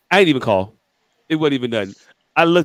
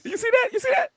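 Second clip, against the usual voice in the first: squeaky, very high self-defence; high-pitched protest; 5 dB quieter than usual. Real, not acted. Showing friends his self-control, in a very high voice. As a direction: pitch it way up, pleading your case.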